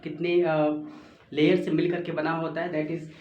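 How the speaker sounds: normal talking voice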